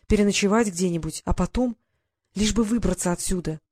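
usual speaking voice

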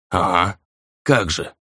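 understated sceptical scoff; disbelieving, sullen brush-off. A narrator's reading. Spirit doubting Rei's release, sceptical. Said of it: "скептически отозвался тот".

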